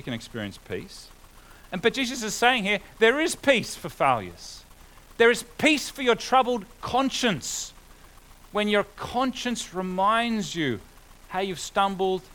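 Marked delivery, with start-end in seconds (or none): 1.7-12.2 louder, higher, driving the point home; rising preacher's fervour; preaching at full force. Acted. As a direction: proclaim it to the congregation.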